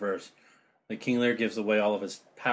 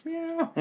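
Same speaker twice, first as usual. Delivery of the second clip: high squeaky meow; cat meow. Pretend. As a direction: toss off a silly cat meow. Cat joke after broken glass.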